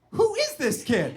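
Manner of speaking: high, slow incredulous cry; drawn-out astonished question; amazed disbelief. Retold or imitated. Amazed reaction to the kid.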